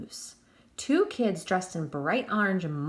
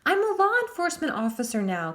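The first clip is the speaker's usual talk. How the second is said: much higher, fast frightened shout; 8 semitones higher, 4 dB louder, faster than usual. A narrator's reading. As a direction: shout to sound brave but fail. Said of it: "Crane shouted".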